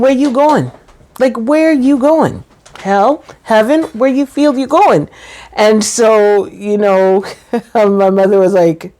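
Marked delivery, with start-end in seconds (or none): 0.0-5.1 higher-pitched, playfully insistent questioning; mock questioning. Daughter retelling what she asked her mother, mock questioning. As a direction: press the question in a teasing singsong.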